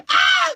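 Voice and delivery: very high mock shriek; imitated girls' scream. Girls screaming back at him, imitated high shriek. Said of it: "they screamed at me".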